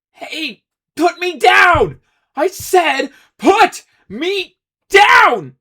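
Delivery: high, loud, slow furious yell; angry shout. Acted. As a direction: yell as you're carried off. Ace floated away, struggling.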